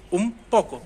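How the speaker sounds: उन said as um before पोको; n turns to m before p. Shown as a demonstration. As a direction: close the lips on उन. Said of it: "मैं उन पोको नहीं बोल रहा हूँ".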